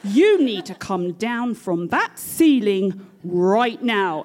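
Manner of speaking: loud, slow, commanding British order; stern, deliberate British telling-off; British voice moment. Acted. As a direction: lay down the law in emphatic British English. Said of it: "it's a British voice moment".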